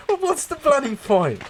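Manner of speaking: a hammed-up huffy retort; indignant quote. Retelling the sketch, high and huffy.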